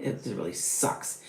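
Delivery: slow, whispered grumble; muttered aside. Real, not acted. Investor mutters about stock losses, slow and whispered. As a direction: sigh it out under your breath.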